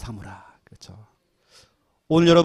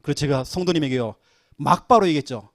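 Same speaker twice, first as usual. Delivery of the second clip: usual speaking voice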